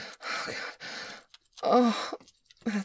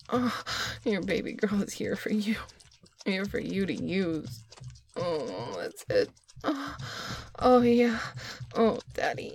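building ecstatic moans. Acted. Climax of acted sex scene.

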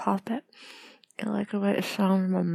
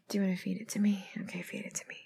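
sultry whisper; 6 dB quieter, faster than usual. Acted. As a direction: whisper it close and intimate. Whispered intimate roleplay.

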